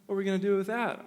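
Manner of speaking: high-pitched, incredulous question; imitated startled cop. Retold cop startled by huge knife.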